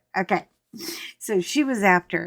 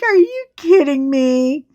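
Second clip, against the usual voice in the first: louder, higher, cracking up; can't believe what she's seeing; incredulous laughter; laughing disbelief; 6 semitones higher, 6 dB louder than usual. Retold or imitated.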